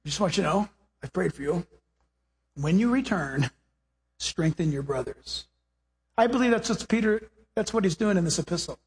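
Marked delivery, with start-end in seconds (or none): 0.0-5.5 tender Jesus. Retold or imitated. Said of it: "And then Jesus says".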